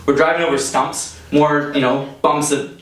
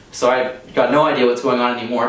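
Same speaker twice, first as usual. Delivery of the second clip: normal talking voice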